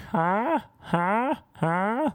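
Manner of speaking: high, squeaky huh; mock her strange sound; weird huh. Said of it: "You're like".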